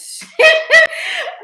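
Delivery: a burst of high, loud laughter. Real, not acted. Laughs about her eyelashes.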